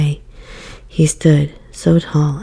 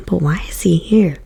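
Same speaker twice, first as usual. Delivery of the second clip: usual speaking voice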